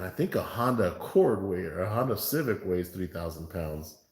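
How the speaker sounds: usual speaking voice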